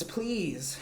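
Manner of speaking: ordinary talk, nothing marked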